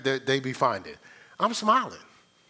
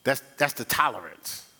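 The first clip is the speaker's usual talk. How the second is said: ordinary talk, nothing marked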